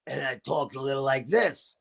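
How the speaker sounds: low, soft lost-voice demo. Retold or imitated. Guest shows restaurant story's lost voice, low and hoarse. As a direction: talk low, as if the voice has gone. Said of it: "I had lost my voice".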